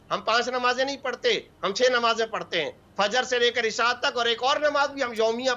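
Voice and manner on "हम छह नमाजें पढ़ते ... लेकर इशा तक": ordinary talk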